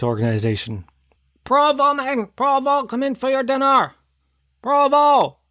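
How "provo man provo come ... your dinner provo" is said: calling a dog. Retold or imitated. Neighbour yelling for the dog, high calling voice. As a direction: call the dog from the back door.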